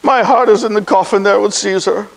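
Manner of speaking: low, subdued mourning; grief-stricken. Acted. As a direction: let the voice sink under stage grief. Overcome with grief at the coffin.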